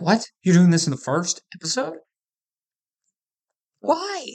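incredulous retold reaction, ending on a high-pitched "why"; baffled, mock-shocked questioning; disbelief. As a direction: relive your disbelief, voice leaping up on the last word. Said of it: "I couldn't believe they did it".

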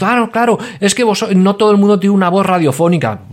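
high, mocking excuse voice; mock listener excuse. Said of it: "y dices".

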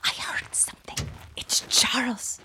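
frightened night whisper; whispering wife at four in the morning. Acted. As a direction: breathe it to your sleeping husband. Waking husband at night.

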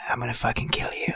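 soft voiceless whisper, low and secretive; whispered threat. Acted. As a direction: whisper it like a threat in someone's ear. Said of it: "Dak leans over to Pox and whispers in".

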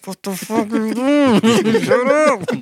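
high-pitched retold outburst, breaking into laughter; shocked telling-off. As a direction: scold in a high voice, cracking up. Retelling his shocked reply to outburst.

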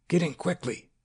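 urgent, high near-shout. A narrator's reading. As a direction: snap it out in alarm, almost a shout. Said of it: "Leon almost shouted the words".

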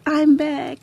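high joyful replay of the line; excited quote. Retold or imitated. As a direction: repeat your happy return. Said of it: "when I said".

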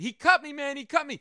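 high, loud yell of pain; panicked shout of a man just stabbed; retold scream. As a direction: yell it like you just got cut. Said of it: "he starts screaming".

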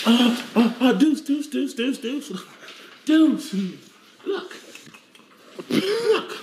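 high, excited calling at the dog; sudden high-pitched excitement; excited squeal. Real, not acted.

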